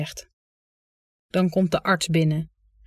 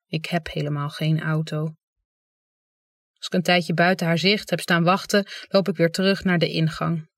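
plain reading voice

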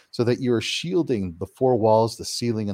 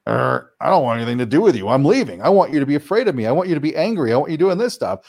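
louder, put-on voice of a peeved dark entity; annoyed negative entity; 3 semitones higher, 5 dB louder, faster than usual. Harmful entity rejecting the kind homeowner: louder, peeved creature voice. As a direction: sneer like a spirit that wants fear. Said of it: "this thing is like".

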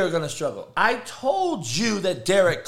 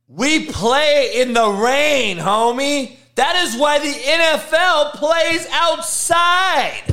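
ordinary talk

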